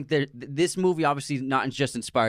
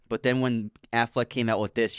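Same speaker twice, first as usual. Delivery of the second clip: usual speaking voice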